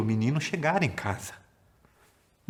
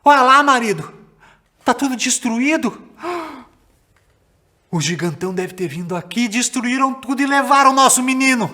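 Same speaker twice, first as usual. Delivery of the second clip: higher, much louder panicked cry; mother shouting; 11 semitones higher, 8 dB louder than usual. Acted. Mother alerting her husband the field is destroyed, panicked shouting. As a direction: yell for her husband in alarm. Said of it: "ela começou a gritar".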